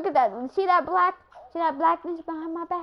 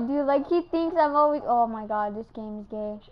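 usual speaking voice

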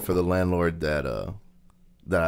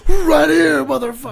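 high, loud, hammed-up shout; mock furious yell; 8 dB louder than usual. The friend playing him yelling at the crackhead, mock shout. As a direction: bark it like you're about to fight. Friend acts out imagined retort.

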